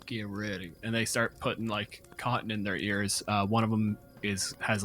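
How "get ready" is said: ordinary talk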